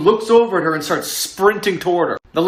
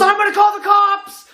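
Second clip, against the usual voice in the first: much higher, shouted warning; threatening shout; 3 dB louder than usual. Retold or imitated. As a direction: yell at the intruder outside. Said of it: "The girl stands up and goes".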